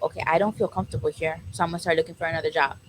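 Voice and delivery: soft, quick thought to self; quiet resolve. Retold or imitated. Worker deciding to leave a job, quiet and quick. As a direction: murmur it fast, like thinking to yourself. Retold private thought at work.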